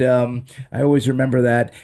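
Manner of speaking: normal talking voice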